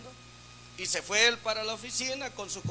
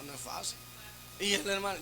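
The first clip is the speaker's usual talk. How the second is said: ordinary talk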